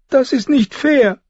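put-on very high, slow girlish complaint; tearful protest. Tearful girl protests being sent to her room, wailing. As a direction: wail your protest high and drawn out.

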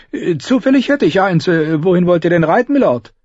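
high, quick innkeeper voice; hesitate, then chatter high to a customer; innkeeper character. A narrator's reading.

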